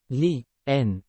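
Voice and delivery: leaning broken into Li and N, one at a time; syllable-by-syllable demo; split into syllables. Shown as a demonstration. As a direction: spell out the two syllables of leaning. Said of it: "Two syllables".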